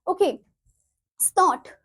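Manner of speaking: normal talking voice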